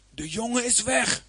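high, alarmed cry without extra volume. Acted. Ruben to his brothers on finding the pit empty, crying out. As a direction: call it out high in mild alarm. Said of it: "riep hij".

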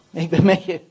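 low, loud, firm promise; weighty, forceful reassurance; firm assurance. Acted. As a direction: state it solidly and low. God's answer, first firm take.

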